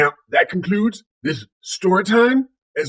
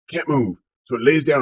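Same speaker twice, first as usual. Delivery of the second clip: usual speaking voice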